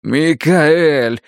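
pleading. Acted. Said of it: "взмолился Рейдер".

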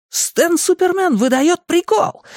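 very high, hyped-up whoop; gleeful exclamation. A narrator's reading. Joker hails Stan's quip to the gang, exclaiming. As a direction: crow it like a mock announcer. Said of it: "воскликнул Ричи".

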